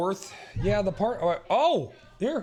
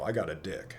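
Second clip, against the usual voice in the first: deep mock voice; 12 semitones lower, 11 dB quieter than usual. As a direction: drop way low and growl it out. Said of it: "have you ever just been like".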